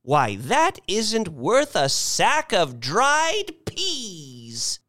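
put-on high, slow old-lady scorn; scornful old lady. A narrator's reading. Missus Bellows judging the rocket worthless, scornful.

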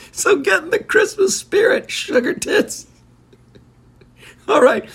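breathless, high wheeze of laughter; real laughing. Real, not acted. Host giving a cheeky holiday send-off, laughing. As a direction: squeeze the words out while cracking up. Cracking up at own joke.

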